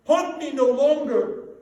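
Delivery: loud, high, frantic cry; desperate shout. Acted. Scrooge shouting at the ghost to stop haunting.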